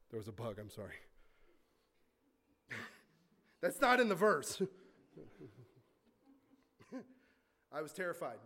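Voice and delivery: real laugh through the words, quick; giggling after a bug interrupts; amused, laughing it off; laughing at himself. Real, not acted. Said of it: "There's a bug here, sorry".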